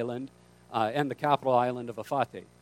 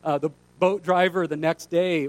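normal talking voice

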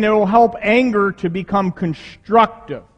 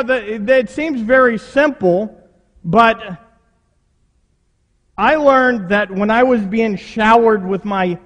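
ordinary talk

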